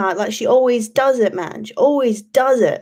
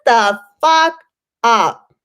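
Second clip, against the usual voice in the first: retold snap; 2 semitones higher, 4 dB louder, much slower than usual. Turning round in class to silence a chatty girl, slow loud snap. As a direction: bark it at the chatty schoolgirl, slowly. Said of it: "turn and be like".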